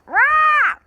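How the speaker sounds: high loud rah squawk; loud parrot squawk. Acted. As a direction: screech like a parrot. Parrot's noise cry.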